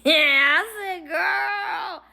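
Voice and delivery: high, loud squeal of amused shock; gleeful, gossipy outburst; laughing shriek. Retold or imitated. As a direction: crack up and squeal it. Teller reacts, laughing, to the story.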